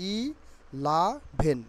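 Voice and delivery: "ই লা ভেন" split into three beats; "ই লা ভেন" broken up as i, la, bhen; syllable by syllable. Shown as a demonstration. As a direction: say "ই লা ভেন" with gaps between syllables. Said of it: "ইলাভেন বলতে পারি".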